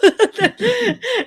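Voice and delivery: high embarrassed giggle; burst of laughter. Real, not acted. Embarrassed laugh mid-sentence.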